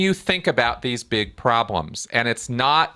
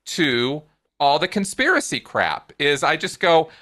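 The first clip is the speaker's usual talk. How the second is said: ordinary talk, nothing marked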